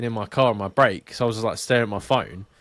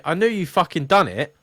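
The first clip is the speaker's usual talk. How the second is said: pitched up, exasperated retelling; mock outrage, pitched higher; retold angry rant; 7 semitones higher than usual. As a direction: replay the fury at the head-shaking driver. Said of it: "And I was like".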